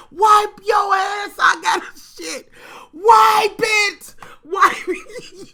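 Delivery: high, slow shouted orders; hollering. Retold or imitated. Auntie hollering orders at the cousin, high and slow. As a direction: yell it over and over like a drill. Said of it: "this whole motherfucking theme of".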